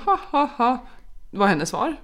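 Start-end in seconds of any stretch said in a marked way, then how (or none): 0.0-0.9 mocking laugh. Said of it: "Och hon bara".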